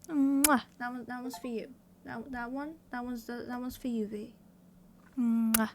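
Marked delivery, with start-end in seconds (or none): 0.1-0.6 loud smacked kiss into the mic; blown kiss. Real, not acted. Streamer sends one viewer a kiss, loud smack. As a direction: blow a big audible kiss.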